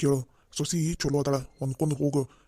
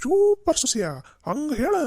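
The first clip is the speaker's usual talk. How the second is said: much higher and louder delighted cheer; excited cheering; 9 semitones higher, 5 dB louder, slower than usual. Acted. Old woman cheering the daughter-in-law's retort, high excited cheer. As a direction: cheer her on with glee.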